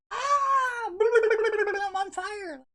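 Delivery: high drawn-out mock scream; burning scream. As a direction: scream like he's on fire. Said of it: "I want him to be like".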